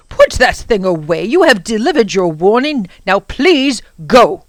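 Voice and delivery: loud, slowing firm order; stern command. Acted. Professor ordering the Prock to leave, loud and stern. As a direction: drive him out with authority.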